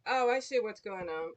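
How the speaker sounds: normal talking voice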